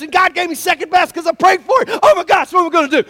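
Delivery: a worried man spiraling over his prayer, in rising mock panic; panicked freakout. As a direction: rattle it off fast, then spiral up into hammed-up panic. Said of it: "you freaked out and you went".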